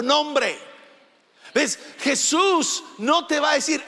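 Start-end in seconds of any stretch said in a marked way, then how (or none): none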